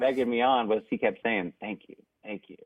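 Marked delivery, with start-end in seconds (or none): 1.6-2.6 very soft, imitated murmur of thanks; hushed thanks. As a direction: say it under the breath, as a quoted aside. Retelling mentor's whispered encouragement.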